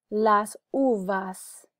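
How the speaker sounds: "Las uvas" slow, with a gap between words; slow, deliberate "Las uvas" for repeating; careful pronunciation model. Shown as a demonstration. Teacher invites students to repeat, slow careful model. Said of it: "You can repeat for pronunciation".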